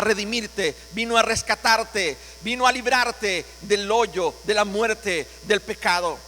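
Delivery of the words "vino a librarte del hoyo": usual speaking voice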